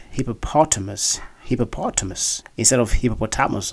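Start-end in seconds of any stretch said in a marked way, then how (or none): 0.1-2.4 hippopotamus with stress on POT; correct pronunciation. Shown as a demonstration. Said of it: "word is actually pronounced as". 2.9-3.7 hippopotamus as hippo-po-TAM-us; mispronunciation. Shown as a demonstration. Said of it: "many of you have been pronouncing".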